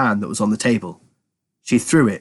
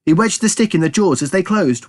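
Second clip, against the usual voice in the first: usual speaking voice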